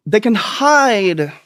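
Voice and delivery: they can hide, said slow and stretched; drawn out slowly. Shown as a demonstration.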